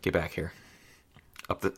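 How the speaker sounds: whisper. Acted. Player whispers a message back to Shamdar, whisper. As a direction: softly murmur a secret summons. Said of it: "uh, whisper".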